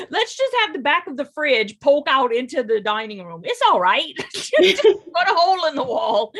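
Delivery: loud laughing through words. Real, not acted. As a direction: laugh it out, joking. Joking while laughing.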